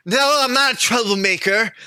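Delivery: high, pleading denial; pleading protest. Acted. Bird protesting to the guard, high and pleading. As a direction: insist on innocence in a high voice.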